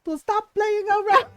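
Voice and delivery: high, loud scolding mother; mock angry mom. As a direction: tear into him like a furious mom. Mom scolding son, beating him.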